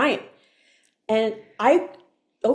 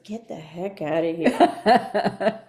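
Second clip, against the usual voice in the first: lightly voiced naysayer, slow and low; dismissive inner voice; 4 semitones lower, slower than usual. The other shoulder voice scoffing at church, dismissive. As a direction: drag it out low, mock dismissal. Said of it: "the other one says".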